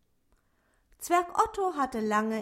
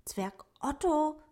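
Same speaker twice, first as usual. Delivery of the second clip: higher, excited rabbit voice; 7 semitones higher than usual. A narrator's reading. Lino the rabbit greets Otto, excited and higher. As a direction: gasp his name in excitement. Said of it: "flüsterte Lino ganz aufgeregt".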